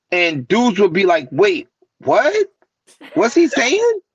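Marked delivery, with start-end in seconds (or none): 1.3-4.0 imitating confused listeners, pitch leaping high; puzzled high-pitched double take, acted out; mock bafflement. Imitating dudes hearing his freestyle, high baffled questioning.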